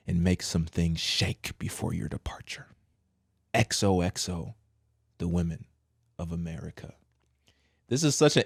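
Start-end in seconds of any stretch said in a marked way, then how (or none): 0.1-6.9 mock whisper. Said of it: "She said".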